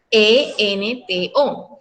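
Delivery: letters E N T O said one by one, very slow; spelled out slowly. Shown as a demonstration. Teacher spells the rhyme ending for students, very slow. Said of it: "termina la palabra en".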